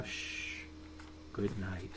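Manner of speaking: soft lullaby whisper; hushed goodnight. Acted. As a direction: hush it like a sleeping child is near. Final bedtime goodnight hushed.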